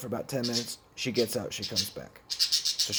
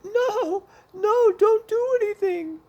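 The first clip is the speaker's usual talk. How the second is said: very high falsetto mimicry of her protest; adamant friend imitation; 6 dB louder, slower than usual. Friend begging him not to act, adamant falsetto imitation. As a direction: squeal it in a mock-frantic falsetto. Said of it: "She was real adamant about that".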